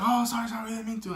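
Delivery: high-pitched, hammed-up sheepish sorry; mock apology. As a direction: imitate someone apologizing in a high little voice. Mimics shooters apologizing to her.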